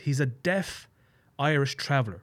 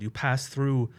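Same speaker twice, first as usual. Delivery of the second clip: ordinary talk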